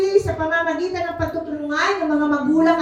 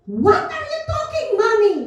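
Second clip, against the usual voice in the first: imitating her daughter; 12 semitones higher than usual. Daughter Bless quoted, incredulous.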